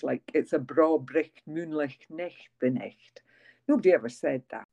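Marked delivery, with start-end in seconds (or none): 0.3-3.2 corny Scottish catchphrase in broad accent; slow, hammy Scottish accent; broad Scots. Shown as a demonstration. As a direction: roll out the broad Scots, slowly. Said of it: "ridiculously corny ones".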